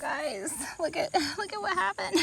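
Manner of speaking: high, soft laughing disbelief; laughing dismay. Real, not acted. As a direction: laugh it off while showing the mess. Showing ruined pumpkin, laughing.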